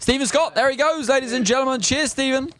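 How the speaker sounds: high-pitched showman's farewell; hearty send-off. Acted. Host to departing comedian, hearty send-off. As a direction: send him off like a stage announcer.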